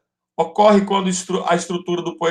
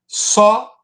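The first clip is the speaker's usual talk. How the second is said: "Só" punched as one loud, higher beat; syllable-count demo; 5 semitones higher, 5 dB louder than usual. Shown as a demonstration. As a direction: call out "Só" as a single strong syllable. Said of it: "uma sílaba".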